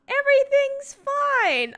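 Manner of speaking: high, slow, strained fake calm; overly high fake-fine voice; forced cheer. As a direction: insist you're fine while crying. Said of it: "instant tear wipe".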